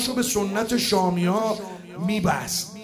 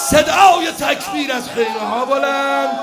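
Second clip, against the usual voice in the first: higher, much louder climactic shout; full-force preaching shout; 8 semitones higher, 12 dB louder than usual. Acted. Preacher roaring the killing blow to the mourners, full shout. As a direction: roar the killing blow to the crowd.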